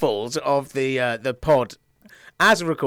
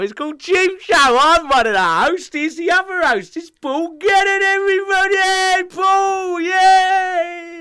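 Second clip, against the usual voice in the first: high, loud goofy announcer voice; stupid silly voice; 13 semitones higher, 5 dB louder, slower than usual. Acted. Host hamming up the podcast intro, goofy high voice. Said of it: "you and your stupid fucking voice".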